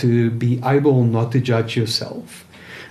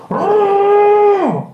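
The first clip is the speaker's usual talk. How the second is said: high muffled scream into cupped hands; shout into hands; 6 dB louder than usual. Shown as a demonstration. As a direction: scream it out into your palms, muffled. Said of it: "and you shout in your hands".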